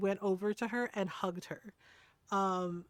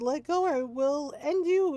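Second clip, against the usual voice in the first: higher, louder, drawn-out mock threat; hammed-up slow threat from a talking arm; threatening arm; 6 semitones higher, 5 dB louder, slower than usual. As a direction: stretch out a playful menacing warning. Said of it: "my arm looked at it and said".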